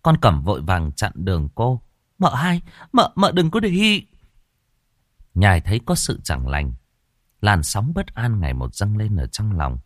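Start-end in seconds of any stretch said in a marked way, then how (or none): 2.2-4.1 higher-voiced, hesitant servant girl; nervous maid stalling the mistress; timid young maid. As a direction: stammer out an answer to your mistress. Maid blocks her mistress.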